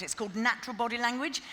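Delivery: usual speaking voice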